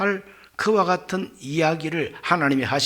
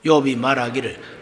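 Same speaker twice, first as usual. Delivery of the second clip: ordinary delivery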